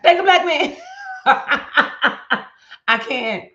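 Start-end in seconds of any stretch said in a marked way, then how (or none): 0.0-0.8 high, loud punchy catchphrase; catchphrase shout. Pretend. To her viewers, trying out her reel tagline, loud and high. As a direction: belt the tagline like a reel hook. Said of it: "Be like".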